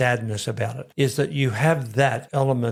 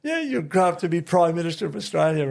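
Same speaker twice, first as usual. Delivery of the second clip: a lightly higher, doting grandma voice; imitating grandmother; 5 semitones higher than usual. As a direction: flatter the boy like a proud nan. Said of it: "she used to pat me on the head and say".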